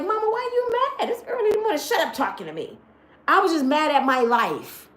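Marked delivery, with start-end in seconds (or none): 0.0-2.8 put-on higher, a light kid voice, then dropping; a hint of a whiny child; imitating her kid. Her kid complaining about her morning mood, lightly voiced. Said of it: "My kid's like".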